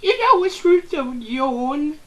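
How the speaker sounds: high, loud nagging mother; shrill parent telling kid to sleep; scolding mom voice. Retold or imitated.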